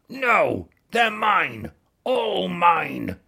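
high, loud greedy scream. A narrator's reading. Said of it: "screamed the bad-tempered ladybird".

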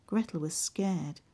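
usual speaking voice

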